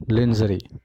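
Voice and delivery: lingerie as "len-zuh-ree"; common mispronunciation. Retold or imitated. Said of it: "इसको लोग पढ़ते हैं".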